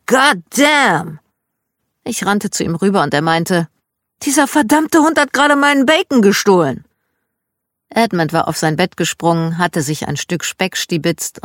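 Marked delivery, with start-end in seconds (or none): 0.0-1.2 higher, louder drawn-out Southern God damn; loud Southern curse. Retold or imitated. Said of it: "hörte ich ein lautes". 4.2-6.8 much higher and louder, outraged at the dog; dad yelling that the dog stole his bacon; furious yell. Retold or imitated. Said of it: "Er war so verdammt sauer auf den Hund".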